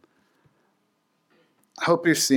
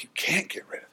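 whispering; 4 semitones higher, 9 dB quieter, much faster than usual. Retold or imitated. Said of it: "she was like".

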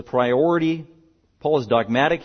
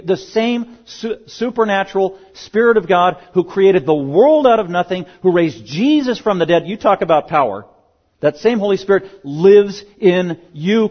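rising, louder preacher's crescendo, higher and higher; preaching at full force; 5 semitones higher, 5 dB louder than usual. Acted. Pastor pressing his congregation to examine themselves, fervent preaching. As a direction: drive the command home with mounting fervor.

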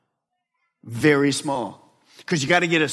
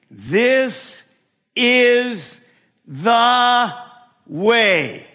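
higher, louder, slow and solemn; voice of God; 7 semitones higher, 5 dB louder, much slower than usual. Acted. God's voice telling the listener the way, solemn. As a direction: speak as God guiding the sheep. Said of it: "a voice behind you saying".

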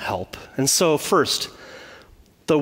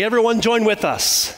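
raised, inviting call to sing along; worship leader's call; 6 semitones higher than usual. Acted. Worship leader starting the song and inviting everyone, lifted call. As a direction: pitch it up like you're rallying a congregation. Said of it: "It'd be like them saying".

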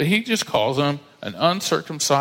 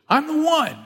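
mock eager boast; 13 semitones higher than usual. Mock eager self-belief.

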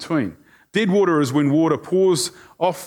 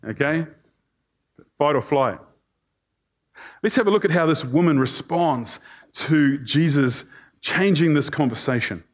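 normal talking voice

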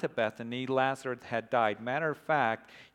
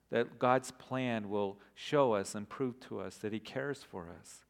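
softer, lower, unhurried prayer voice; quiet prayer; 3 semitones lower, 4 dB quieter than usual. Acted. Pastor praying over the congregation before the reading, softly. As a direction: pray gently with long pauses.

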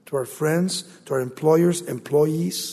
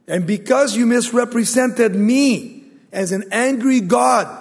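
loud, raised preacher voicing God's rebuke; stern rebuke; 8 semitones higher, 9 dB louder than usual. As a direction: thunder the accusation at Moses, pitch lifted. God rebukes Moses, acted out.

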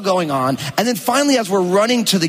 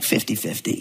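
low, softer grumpy mutter; 6 semitones lower, 6 dB quieter than usual. Retold or imitated. Tired husband answering his wife grumpily. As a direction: grumble it flatly. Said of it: "I'm just grumpy".

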